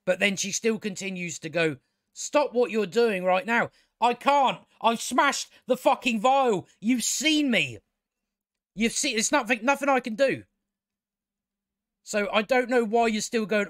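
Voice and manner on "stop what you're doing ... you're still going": exasperated retold argument. Voicing two sisters arguing.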